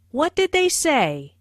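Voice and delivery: "say" emphasized with falling pitch; falling what-question landing on "say"; emphasis on SAY. Shown as a demonstration. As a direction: stress "say", then drop the tune. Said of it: "is emphasized".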